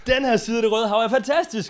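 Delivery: loud, forceful echo; match the preacher's full force; triumphant preaching. Real, not acted. Interpreter relaying the victory to the congregation, loudly triumphant.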